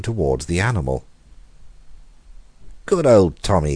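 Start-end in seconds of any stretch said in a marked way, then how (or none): 2.9-3.7 called out loudly, a touch raised; hearty call to the cat; cried out. A narrator's reading. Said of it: "he cried".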